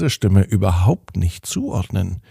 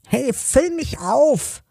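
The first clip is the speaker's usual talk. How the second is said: very high, slow whiny cup voice; whining cup; slower than usual. A narrator's reading. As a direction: whine to be filled up like a little cup. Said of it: "das Gejammere der Tasse".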